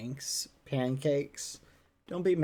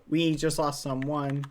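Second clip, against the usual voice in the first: ordinary talk, nothing marked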